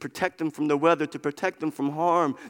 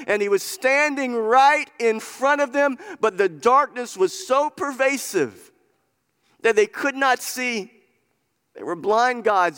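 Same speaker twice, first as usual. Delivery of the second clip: higher, driving pulpit delivery; impassioned preaching; 7 semitones higher, 4 dB louder than usual. Real, not acted. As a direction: preach it with insistent emphasis. Preaches with rising force.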